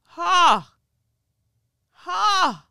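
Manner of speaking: short high disbelieving laugh; a sharp laugh at how awful it was; bitter laugh. Real, not acted. Reacting to the enacted inquiry accounts, bitter high laugh.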